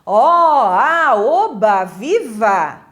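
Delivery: loud, cheering exclamations; hammed-up cheers of joy; demonstrated joy. Shown as a demonstration. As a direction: call out each one with drawn-out delight.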